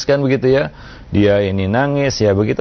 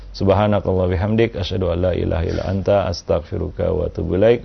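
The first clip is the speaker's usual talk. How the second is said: lower, softer Arabic closing prayer recited quickly; 5 semitones lower, 4 dB quieter than usual. Acted. Ustaz closing the morning session for listeners with a soft prayer. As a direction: murmur the closing dua and salam, winding down.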